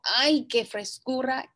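ordinary delivery

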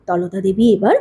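ordinary delivery

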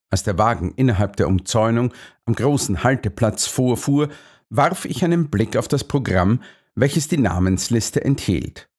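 usual speaking voice

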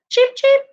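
high demo cheeping; chick cheeps. Shown as a demonstration. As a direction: chirp it for the kids to copy. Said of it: "Can you guys cheep".

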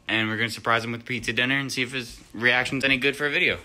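ordinary delivery

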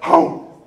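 a sharp, breathy, loud snap of a dog gobbling; dog wolfing a treat, loud unvoiced snap; loud gulping dog chomp. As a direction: bark out the gulp like a dog scarfing a treat.

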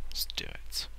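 a hushed whisper to himself. Real, not acted. Psyching himself up before the jump, whispered. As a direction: breathe the words, nearly silent. Quiet resolve before a run.

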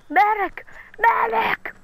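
high calls of "Medic!"; man down shout. Real, not acted. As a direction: yell "Medic!" for help. Calling for help in game.

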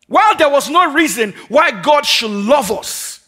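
high, loud, building sermon cry; full-force preaching. Acted. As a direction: preach it out to the whole room.

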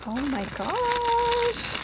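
high hushed amazement; awed hush. Real, not acted. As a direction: let it out in quiet wonder. Discovering a homemade cookie.

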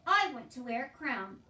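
loud, earnest little plea; insistent wish. A narrator's reading. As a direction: declare the wish firmly. Meg insists on her wish.

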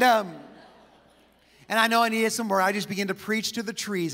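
normal talking voice

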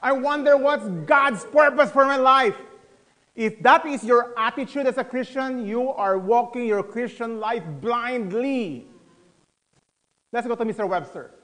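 ordinary delivery

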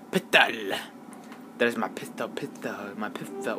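soft, lisped PISTOL with TH in the middle; silly lisped voice. Pretend. Gamer toying with the word pistol, silly soft lisp. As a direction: say PISTOL as "pith-o", playfully quiet. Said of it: "Why am I talking like this?".

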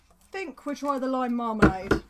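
usual speaking voice

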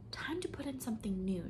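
breathy whisper with a sigh; tired whispered aside. A narrator's reading. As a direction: whisper it to the dark, weary. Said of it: "told the waiting darkness with a sigh".

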